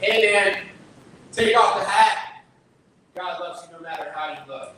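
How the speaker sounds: loud, high call across the street, slowing on the hat; yelling across the street. Retold or imitated. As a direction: shout it over the traffic like a friendly heckle.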